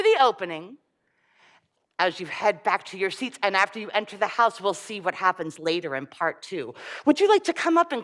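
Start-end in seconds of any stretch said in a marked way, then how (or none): none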